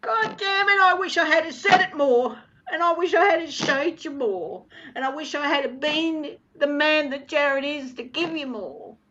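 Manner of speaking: loud, high-pitched tearful outburst, easing toward the end; real anguish, pushed high and loud; anguished regret. Real, not acted. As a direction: burst out with regret while crying. Channels dead father's tearful regret.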